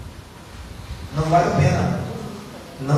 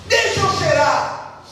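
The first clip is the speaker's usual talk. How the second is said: suspicious father sniffing; 9 semitones higher, slower than usual. Retold or imitated. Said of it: "o pai pergunta".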